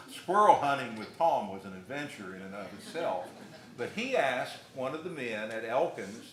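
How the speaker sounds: normal talking voice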